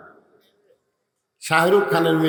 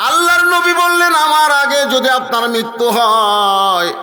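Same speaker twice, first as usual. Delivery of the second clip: loud, high preacher's chant, stretched endings; full-force preaching; 10 semitones higher, 7 dB louder, slower than usual. Acted. Preacher quoting the Prophet to the crowd, full-force chanting. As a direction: proclaim it high and drawn out.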